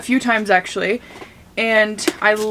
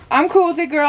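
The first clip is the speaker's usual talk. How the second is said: playful high voice; 7 semitones higher, 3 dB louder, faster than usual. Real, not acted. Playful baby talk to pet.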